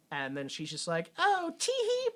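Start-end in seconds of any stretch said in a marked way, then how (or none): 1.2-2.1 very high, girlish pretend titter. [name] doing her thing for police, cutesy act. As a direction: squeak out a coy fake giggle.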